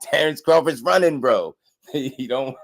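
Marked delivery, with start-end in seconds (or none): 0.0-1.6 higher, loud mock boast; imitating bragging father. Mocking the father claiming Crawford is scared. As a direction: sneer that the rival is running. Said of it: "He be like".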